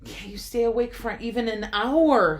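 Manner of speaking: Jesus rebukes his sleeping friends angrily; angry. Acted. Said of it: "He was angry".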